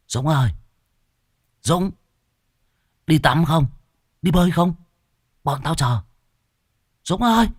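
put-on boys calling a friend out to swim. A narrator's reading.

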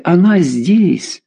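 high, very drawn-out exclamation; exhaled relief. A narrator's reading. Mother realising her missing daughter is here, relieved exclamation. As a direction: stretch it out in amazed relief. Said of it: "выдохнула Клавдия".

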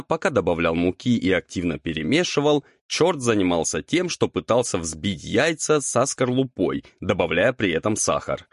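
usual speaking voice

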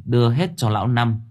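plain reading voice

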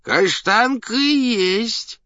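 put-on high, drawn-out drunken cry; tipsy rattling tenor. A narrator's reading. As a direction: confirm it in a tipsy, quavering tenor. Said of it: "подтвердил пьяненький дребезжащий тенорок". (пьяненький дребезжащий тенорок)

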